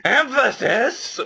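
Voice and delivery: high, drawn-out mock scream; scream for emphasis. Shown as a demonstration. Demonstrating screaming things for emphasis, high mock scream. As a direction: screech it slowly, pitch shooting up. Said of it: "I scream things for emphasis".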